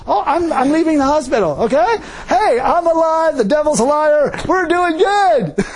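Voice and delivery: a patient proclaiming he's alive; triumphant exclaiming. Retold or imitated. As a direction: crow your joy at leaving the hospital. Retelling his hospital exclamations.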